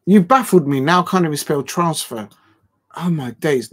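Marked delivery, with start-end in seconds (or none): 0.0-2.3 exasperated outburst. Real, not acted. Streamer blaming viewers for his muddle, loudly exasperated. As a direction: burst out, thrown off your task. Exasperated at chat, can't spell.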